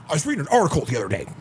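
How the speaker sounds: lightly imitated brash comic delivery; fast, brash joke setup, lightly put on; Andrew Tate. Podcaster retelling a joke he found funny, brash delivery imitated. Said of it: "Andrew Tate's delivery is funny".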